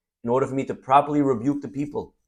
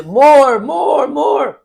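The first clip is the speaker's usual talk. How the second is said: shouted much higher and much louder; gleeful yelling for more; delighted shout; 13 semitones higher, 11 dB louder, much slower than usual. Retold or imitated. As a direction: cry out for more like a happy man in the heat. Sauna-goer begging for more.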